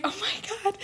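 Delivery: a stunned, overwhelmed squeal; mock dismayed gasp. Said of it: "And I was like".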